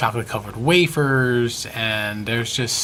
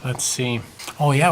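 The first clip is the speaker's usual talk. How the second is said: ordinary talk, nothing marked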